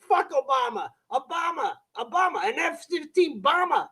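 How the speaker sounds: put-on Boston accent. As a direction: ham up a serious Boston guy. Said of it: "his Boston accent was so hard".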